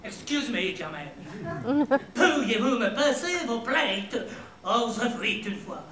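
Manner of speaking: slow, loud English-accented French; hammed-up English accent, very drawn out. Shown as a demonstration. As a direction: stretch the French vowels like an English speaker. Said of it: "c'est l'accent anglais".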